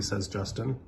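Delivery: plain reading voice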